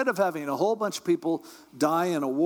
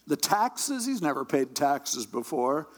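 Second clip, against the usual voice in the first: normal talking voice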